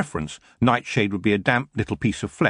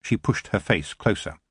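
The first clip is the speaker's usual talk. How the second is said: plain reading voice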